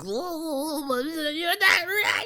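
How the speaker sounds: very high, shrill mocking shriek with a laugh; shrieking mockery. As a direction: screech it like a mocking caricature. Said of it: "'cause, like".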